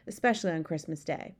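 usual speaking voice

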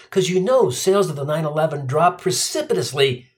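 usual speaking voice

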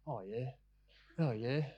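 low-key, soft, uninvested murmur; passive, half-hearted. Acted. Churchgoer going through passive worship, half-hearted. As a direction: give it barely any effort. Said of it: "It's passive worship, where we're just giving".

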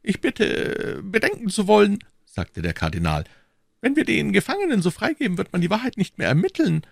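silky churchman stepping back, high voice; cardinal's voice. A narrator's reading. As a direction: play the sly cardinal, pitched well up.

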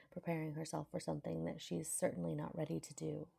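ordinary delivery

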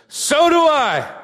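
slow, high, loud affirmation; emphatic retold shout. Bill's reply from the front row, wholehearted shout. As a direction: holler it like you mean it. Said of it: "And Bill goes".

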